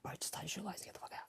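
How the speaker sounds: fast, hushed warning; urgent whisper. Retold or imitated. Guest warns the shaking tasker, urgent fast whisper. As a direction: whisper it quickly, urging him to go.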